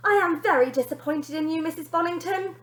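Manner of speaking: high, loud scolding; nanny disappointed with mother; stern nanny. Acted. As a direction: tell her off.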